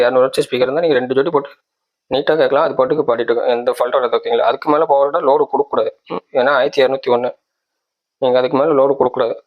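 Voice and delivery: ordinary talk, nothing marked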